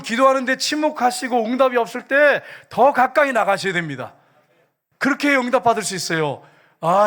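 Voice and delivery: rousing preacher's blessing; forceful preaching. Real, not acted. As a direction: drive the exhortation home to the congregation.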